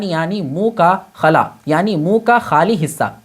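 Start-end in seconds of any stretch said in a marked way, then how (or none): none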